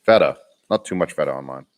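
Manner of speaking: ordinary talk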